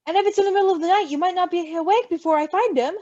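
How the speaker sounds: fast, urgent worrying; hurried Roman. Acted. Roman pleads his case to Patton, hurried. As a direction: rush the words out in worry.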